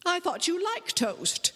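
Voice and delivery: high, hurt protest; aunt hurt her toast is ignored; injured exclaim. A narrator's reading. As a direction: sound wounded that he ignores it. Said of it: "she exclaimed with an injured air".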